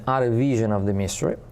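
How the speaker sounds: ordinary talk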